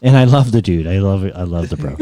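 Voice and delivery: ordinary delivery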